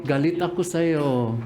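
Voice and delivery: an angry line said sweetly in an Ilonggo lilt; a light Ilonggo sing-song with a hint of affection. Shown as a demonstration. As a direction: make the threat sound tender, Ilonggo style. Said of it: "Na sobrang malambing".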